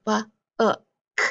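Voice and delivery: "b, uh, k" as three separate sounds; sounding out. Shown as a demonstration. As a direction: break book into "b", "uh", "k". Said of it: "Now I hear".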